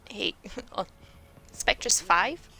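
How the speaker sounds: usual speaking voice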